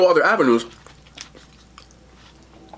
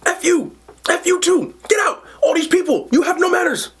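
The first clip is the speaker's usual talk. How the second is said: mock screaming. Cashier screams back at the customer, high mock yell. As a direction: yell it with pitch way up, half laughing. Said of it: "He goes".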